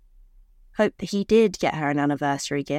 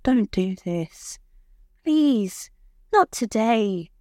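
slow, pleading, breaking high on please; whimpering plea; 4 semitones higher, much slower than usual. Acted. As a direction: beg him tearfully. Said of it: "she whimpered".

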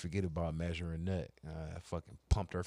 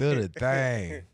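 loud, slow, raised-pitch chant; drawn-out playful echo; 4 semitones higher, 7 dB louder, much slower than usual. Pretend. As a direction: draw each word out teasingly. Repeats the drawn-out urging.

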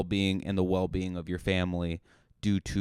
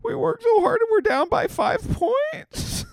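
very high, louder blubbering wail; mock crying; 6 dB louder than usual. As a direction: sob it out like an overgrown baby. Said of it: "I would be crying on the bench".

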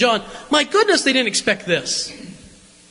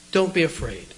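tender reassurance; 7 semitones lower, 6 dB quieter than usual. Acted. Repeating Jesus' comfort softly.

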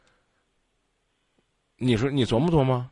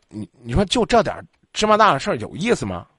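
ordinary talk, nothing marked

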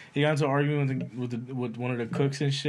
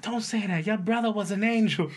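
mock mom; 7 semitones higher than usual. Mock mother scene.

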